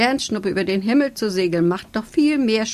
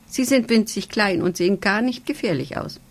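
ordinary delivery

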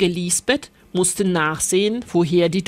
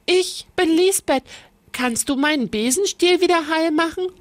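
high, eager little witch; bright asking girl, quick; Lisbeth; 8 semitones higher than usual. A narrator's reading. Lisbeth asks the witch to fix her broom, high and quick.

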